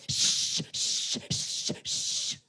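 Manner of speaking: long whispered shushes; shushing librarian. Retold or imitated. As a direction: shush them like a cranky librarian. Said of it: "they always go".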